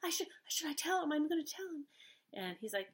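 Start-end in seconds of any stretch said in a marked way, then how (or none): 0.0-1.9 higher mock-fretful woman; dramatic anxious woman. Mocking her big deal about telling him, fretful. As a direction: ham up her worried fuss over telling him. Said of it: "making it like it's a big frickin' deal".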